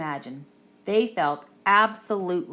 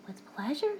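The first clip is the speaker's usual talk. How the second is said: high, soft flamingo voice; gentle flamingo; 8 semitones higher, 7 dB quieter than usual. A narrator's reading. Flamingo to the wolves, high and soft. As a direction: answer sweetly and softly.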